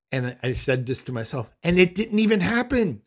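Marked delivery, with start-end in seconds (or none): 1.6-3.0 loud, high-pitched disbelief; exasperated protest. Retold or imitated. Son protesting to himself at mother's made-up story, exasperated.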